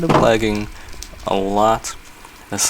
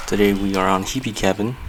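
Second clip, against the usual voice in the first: ordinary talk, nothing marked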